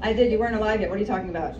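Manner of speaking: ordinary delivery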